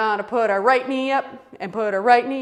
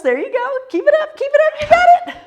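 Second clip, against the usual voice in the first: much higher, loud cheering; excited encouragement; 7 dB louder, faster than usual. Real, not acted. Instructor cheering Zoe through the pose, high and excited.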